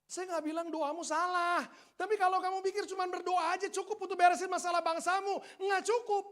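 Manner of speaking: loud, high, emphatic sermon delivery; forceful preaching. Real, not acted. Preacher correcting the congregation on prayer alone, forceful preaching. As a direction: drive the warning home at full voice.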